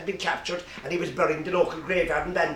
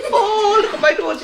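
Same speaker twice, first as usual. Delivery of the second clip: high, slow, loud, laughing; slow high laughing cry over the new nose; laughing wonder; 5 dB louder, slower than usual. Acted. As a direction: marvel at it through laughter. Doctor admiring pig's nose.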